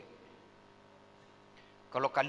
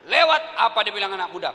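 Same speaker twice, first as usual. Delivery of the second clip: loud quick question; 2 semitones higher, 11 dB louder, faster than usual. Real, not acted. Preacher asks loudly.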